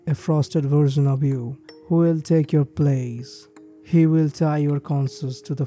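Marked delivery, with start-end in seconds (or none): none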